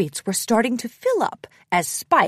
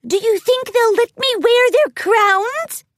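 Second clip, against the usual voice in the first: high, loud little filly voice; overexcited youngster piping up; excited filly; 13 semitones higher, 6 dB louder than usual. A narrator's reading. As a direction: ask in giddy, high-pitched hope. Overexcited filly pegasus asks in story.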